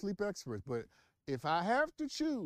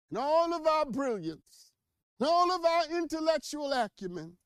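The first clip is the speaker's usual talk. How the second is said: rising impassioned oratory; preacher voice; 7 semitones higher, 3 dB louder than usual. Pretend. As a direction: build it like a Sunday sermon. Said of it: "gotta get my preacher voice for this".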